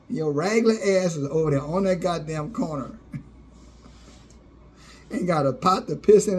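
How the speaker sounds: ordinary talk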